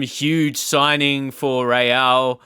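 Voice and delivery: ordinary talk, nothing marked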